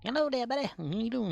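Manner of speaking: high cutesy voice apologising to a viewer; squeaky cute voice. Pretend. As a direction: coo it like talking to a pet.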